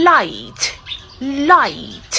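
"light" falling, with released t; clear final t. Shown as a demonstration. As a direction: model "light" with clear l and puffed t. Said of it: "สังเกตว่าจะเสียงถ่ายมันจะ". ("light" เสียง l หน้า t ท้ายชัด)

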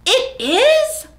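very high, thrilled question; wide-eyed high surprise; amazed. Acted. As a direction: squeal it in delight. Bigger mock surprise.

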